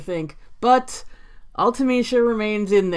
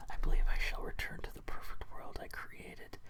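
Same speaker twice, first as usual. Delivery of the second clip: hushed, whispered villain quote; Cyrus voice; 12 dB quieter than usual. Acted. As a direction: whisper it like a brooding edgelord. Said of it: "hearing my Cyrus voice".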